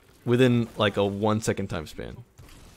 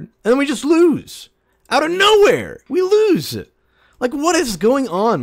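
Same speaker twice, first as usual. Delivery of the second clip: loud, much higher, furious rant; angry rant; 10 semitones higher, 6 dB louder than usual. Real, not acted. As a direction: vent at the teammate who left. Ranting about teammate leaving.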